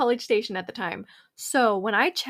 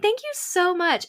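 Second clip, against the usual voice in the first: much higher, sweetly grateful; thanking the guard before darting off, sweet and higher; sugary thanks; 8 semitones higher than usual. Retold or imitated. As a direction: thank him a bit too sweetly. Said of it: "I'm just like".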